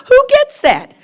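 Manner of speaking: high, loud laugh through the words. Real, not acted. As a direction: burst out laughing mid-question. Laughing at her own boast.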